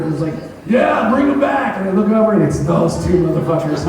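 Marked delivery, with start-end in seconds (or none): 0.7-1.8 a higher, slowed mock heckle; mock heckler shout. Comic imitates the heckler shouting from the back in a higher, slow voice. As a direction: lightly imitate the drunk heckler calling out. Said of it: "I hear this shout".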